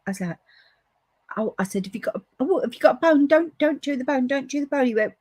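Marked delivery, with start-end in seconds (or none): 1.8-4.9 high-pitched, insistent warning; urgent retold warning. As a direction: act out warning someone off, pitch raised. Retelling a warning to Paul.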